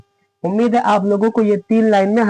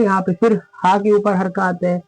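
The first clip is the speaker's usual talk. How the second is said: ordinary talk, nothing marked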